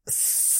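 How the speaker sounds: sharp hiss through teeth; pained hiss. Acted. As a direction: hiss in pain. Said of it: "прошипел он".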